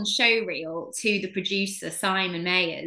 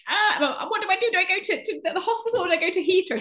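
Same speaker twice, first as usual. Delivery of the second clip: panicky; 10 semitones higher, faster than usual. Retold or imitated. Panicked call to mum about baby.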